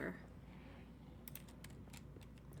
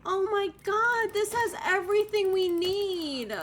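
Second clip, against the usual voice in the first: higher, loud, delighted gush; overjoyed; 9 semitones higher, 5 dB louder than usual. Real, not acted. Streamer thrilled by the house's features, higher and louder. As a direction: gush with joy at the discovery.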